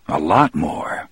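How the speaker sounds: ordinary delivery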